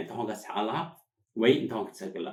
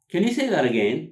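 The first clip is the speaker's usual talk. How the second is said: higher and louder, words run together; natural linked delivery; 7 semitones higher, 7 dB louder, much slower than usual. Shown as a demonstration. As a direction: model it as one quick connected phrase. Teacher models natural linked phrase.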